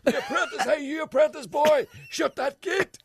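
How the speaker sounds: high, loud shouting politician; politician impression. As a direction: yell at the apprentice boy. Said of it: "for Gregory".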